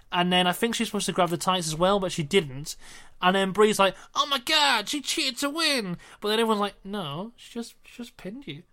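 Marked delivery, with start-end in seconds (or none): 4.2-6.0 high-pitched imitation of an indignant wrestler; mock outrage. Acting out wrestler's outraged complaint. 6.8-8.6 quiet correction. Retold or imitated. Everyone calmly corrects the wrestler.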